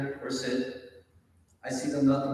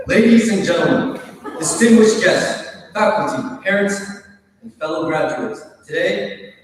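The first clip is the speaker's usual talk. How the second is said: loud, high grand announcer voice; podium speech; 6 semitones higher, 12 dB louder than usual. Pretend. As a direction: boom it like a pompous emcee. Mock formal speech opening.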